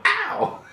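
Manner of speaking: high, sharp funk yelp. Acted.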